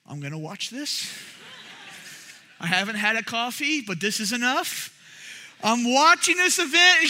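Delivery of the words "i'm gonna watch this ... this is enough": quiet amused aside. Retold or imitated. Street preacher to himself watching the row, hushed aside. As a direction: say it softly to yourself, watching. His hushed reaction to the scene.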